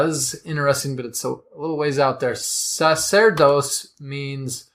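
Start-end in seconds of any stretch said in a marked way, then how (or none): 2.3-4.7 "Sacerdos" said slowly and deliberately; careful Latin. Shown as a demonstration. Tracing the word's Latin root for the cohost, slow. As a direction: lay out the Latin word slowly. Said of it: "explaining Latin root".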